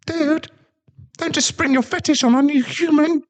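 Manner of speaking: very high, prissy small-dog voice; snooty little Pomeranian. Acted. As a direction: sneer it out like a tiny pampered dog. Small Pomeranian son speaks.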